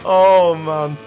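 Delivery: loud, drawn-out exasperated oh man; loud groan of frustration. Real, not acted. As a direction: let it out in frustration. Venting after crash.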